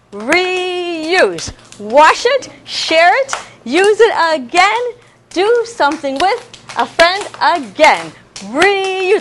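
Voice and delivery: put-on teacher chanting to clapping children; rhyme recital. Shown as a demonstration. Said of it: "reciting reuse rhyme".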